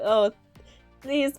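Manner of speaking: ordinary delivery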